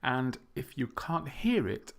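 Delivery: usual speaking voice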